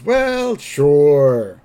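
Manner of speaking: hammed-up agreeable drawn-out answer; Homer. Homer welcoming his father to the table, agreeable. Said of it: "Homer goes".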